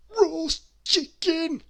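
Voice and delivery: Mister Greedy, shrugging off a cake, in a high slow voice; greedy character voice. Acted.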